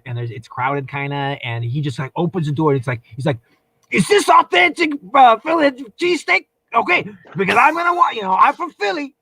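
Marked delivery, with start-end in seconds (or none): 3.9-9.1 put-on a loose Philadelphia accent, pitched up and loud; imitating a pushy Philadelphia customer; Philly guy impression. Philadelphia man announcing to the crowded shop. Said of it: "I wish I could do a Philadelphia accent".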